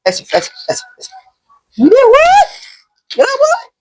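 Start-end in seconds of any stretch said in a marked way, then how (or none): 1.8-3.7 panicked yelp. Real, not acted. Gamer yelping as a mob attacks. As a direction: yelp in sudden alarm.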